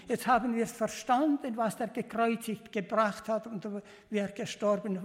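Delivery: normal talking voice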